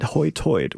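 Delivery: Hoytoid said as "hoy toid", the oy sound for both i's; Outer Banks pronunciation. Shown as a demonstration. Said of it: "the way that the locals pronounce high tide".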